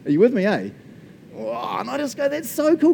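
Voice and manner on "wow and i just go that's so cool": high, gushing wonder; excited amazement. Acted. Preacher amazed that God helps us, high excited gushing. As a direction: gush it high, thrilled and amazed.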